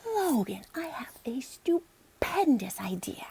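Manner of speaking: slow breathy whisper of a big idea. Acted. Girl whispering her big plan to Logan, slow and hushed. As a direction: hush it like a grand secret. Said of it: "Emily suddenly proclaimed".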